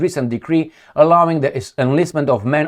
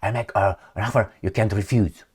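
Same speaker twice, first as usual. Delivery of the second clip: quiet mobster echo; 8 semitones lower, 9 dB quieter than usual. Retold or imitated. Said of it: "that's exactly how he said".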